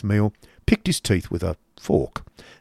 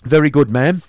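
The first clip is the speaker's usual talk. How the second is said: loud, raised curt reply; brusque uncle; 6 semitones higher, 9 dB louder than usual. Acted. Uncle answering his sister-in-law, brusque. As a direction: answer loudly and shortly. Ralph answers Mrs Nickleby curtly.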